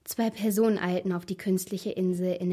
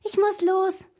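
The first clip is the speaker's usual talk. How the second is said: high-pitched, mildly nervous call; 12 semitones higher, 4 dB louder, slower than usual. A narrator's reading. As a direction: call out hurriedly, a touch nervous. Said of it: "rief Mara nervös". (nervös und hoch gerufen)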